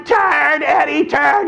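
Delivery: very high, frantic warning cries; panicked yelling. Retold or imitated. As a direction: squeal the warning at the top of your range. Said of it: "We're yelling".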